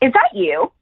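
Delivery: put-on high, amazed little question as the young woman; surprised girl. Retelling the young woman on the train spotting her, surprised. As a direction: lightly mimic her disbelief.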